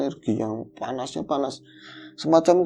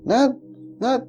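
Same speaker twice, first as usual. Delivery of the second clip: half-scolding call; 11 semitones higher than usual. Retold or imitated. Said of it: "Saya setengah marah-marahin adik saya".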